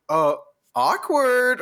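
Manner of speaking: high, slow mock-sarcastic. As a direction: drag it out sarcastically. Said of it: "always hate, like".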